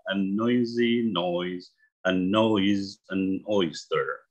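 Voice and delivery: slow, careful tongue twister, each word clear; read slowly. Shown as a demonstration. Teacher shows students the tongue twister slowly, slow and careful. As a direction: ease through it slowly so students follow. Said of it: "bacanya perlahan aja".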